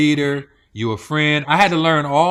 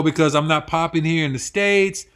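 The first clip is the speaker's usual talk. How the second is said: usual speaking voice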